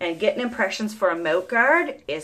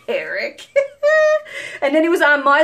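laughing; 7 semitones higher, 7 dB louder than usual. Real, not acted. Laughing about son's polish.

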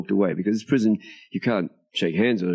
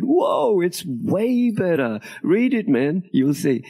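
much higher, stretched-out enthusiastic boast; emphatic assurance; 11 semitones higher, much slower than usual. Retold or imitated. Writer assuring the officer about the new book, enthusiastic. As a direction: retell it with high, gleeful insistence.

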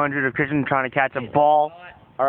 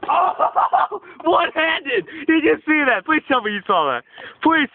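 higher, amazed cheering with a laugh; thrilled disbelief; excited cheer; 12 semitones higher, slower than usual. Real, not acted. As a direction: marvel at the one-handed grab. Cheering a one-handed catch.

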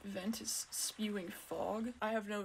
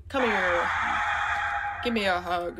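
loud shout, calling someone over; full-voiced playful shout; shouted invitation; 10 dB louder than usual. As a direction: holler it at the game character. Calling out to game character.

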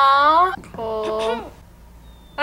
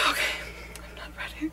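sigh, then whisper it; barely voiced, nervous; breathless whisper; 5 semitones higher, faster than usual. Real, not acted. Overwhelmed before the episode starts.

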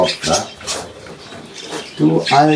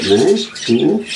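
usual speaking voice

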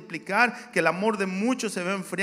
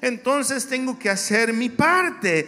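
loud, rising preacher cadence; fiery sermon delivery; preaching; 4 semitones higher, 7 dB louder than usual. Acted. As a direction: preach it to the congregation with force. Preaching at full force.

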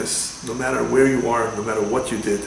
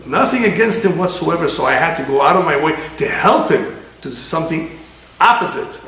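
emphatic; 4 semitones higher, 6 dB louder than usual. Real, not acted. Emphatic preaching point.

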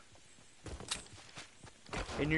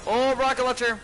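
high, loud burst of excitement; excited find; 8 semitones higher, 9 dB louder than usual. Real, not acted. Gamer spotting a rocket launcher, loud excited yelp. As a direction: yelp with delight at the loot.

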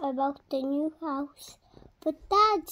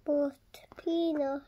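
ordinary delivery, nothing marked

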